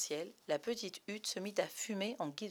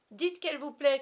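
high, loud eager plea; urgent boyish cry; 7 semitones higher, 10 dB louder than usual. Acted. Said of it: "cria Bonzig".

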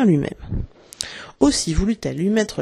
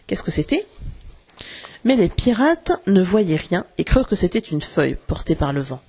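ordinary delivery

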